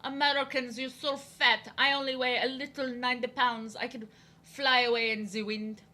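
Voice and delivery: hammed-up French accent, haughty boasting; mocking French girl. French girl putting down American kids in drawing class, mock French accent. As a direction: imitate a mean French classmate with a thick French accent. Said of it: "that's how she acted".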